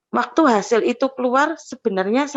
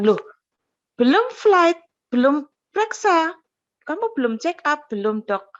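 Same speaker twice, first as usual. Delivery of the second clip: surprised doctor; 5 semitones higher than usual. Retold or imitated. Doctor's startled scolding question.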